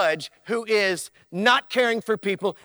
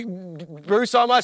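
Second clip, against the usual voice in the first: normal talking voice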